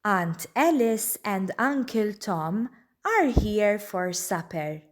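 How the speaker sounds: plain reading voice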